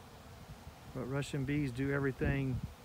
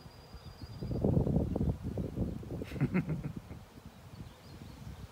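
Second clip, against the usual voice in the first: breathy laugh. Real, not acted. Laughs at own bee voice.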